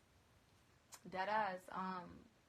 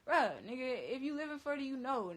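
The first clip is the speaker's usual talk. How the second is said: heated warning; 5 semitones higher, 6 dB louder than usual. Retold or imitated.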